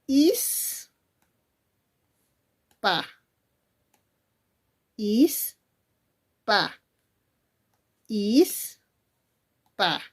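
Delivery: sílabas aisladas con largas pausas; mnemotecnia recitada. Shown as a demonstration. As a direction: alarga "Is" and drop a separate "pa". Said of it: "escucha la mnemotecnia". (mnemotecnia silabeada, con pausas largas)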